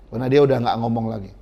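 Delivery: normal talking voice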